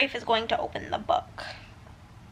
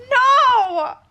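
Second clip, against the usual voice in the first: high, loud cry of no; anguished shout; 11 semitones higher, 7 dB louder than usual. Real, not acted. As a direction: scream it in shock. Shock at character's injury.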